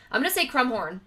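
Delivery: ordinary talk, nothing marked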